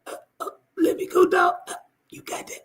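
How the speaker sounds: strangled, weak squeak; choked pleading. Acted. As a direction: gasp out a plea while being choked. Acting out someone choked, tapping out.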